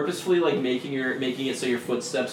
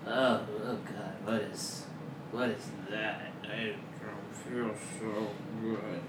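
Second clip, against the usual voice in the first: woozy from the ether; 2 semitones lower, 10 dB quieter than usual. Acted. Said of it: "he's like".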